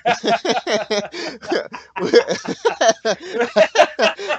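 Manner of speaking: loud high burst of laughter; big laugh. Real, not acted.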